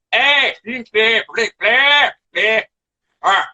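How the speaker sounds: mock Scottish gibberish, the way outsiders imagine it; mock Scottish accent. Mocking how people think Glaswegians talk, in a hammed-up Scottish accent. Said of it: "nobody sounds like".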